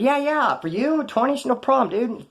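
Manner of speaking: mock-obliging retold reply; eager agreement. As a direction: agree readily, voice lifted. Retold homeboys readily agreeing to request.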